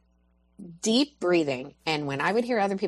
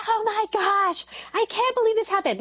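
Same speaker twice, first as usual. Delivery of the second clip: mock frantic mom. Screaming mom voiced.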